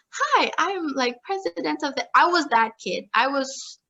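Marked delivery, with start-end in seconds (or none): 0.1-2.1 light mock eager kid, pitched up; movie overachiever kid. Poking fun at the movie kid she once was, mock eager. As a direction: imitate a keen student-president type, higher. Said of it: "those movies that it's like".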